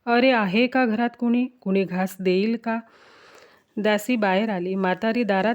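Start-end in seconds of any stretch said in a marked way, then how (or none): none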